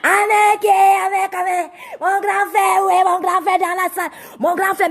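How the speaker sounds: loud, high calling out. Real, not acted. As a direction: call across the room. Calling out to brother in room.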